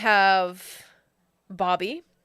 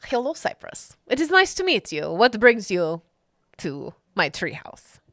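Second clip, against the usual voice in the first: put-on Germanic Wilhelm accent; 4 dB louder than usual. [name] welcoming Cypress to the treehouse, mock Germanic accent. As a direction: greet them like a stiffly courteous Germanic host. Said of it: "He sounds like a Wilhelm".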